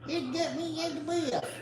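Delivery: high, soft voice speaking for the dog; voicing the dog. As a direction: talk as if you're the panting pet, softly and high.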